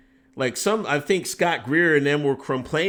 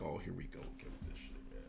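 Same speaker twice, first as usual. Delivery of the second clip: fast, whispered weary groan; whispered aside; 11 semitones lower, 26 dB quieter, faster than usual. Retold or imitated. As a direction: whisper it like an eye-rolling aside. His own weary inner reaction.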